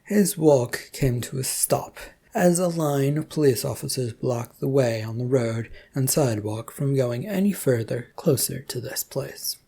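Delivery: usual speaking voice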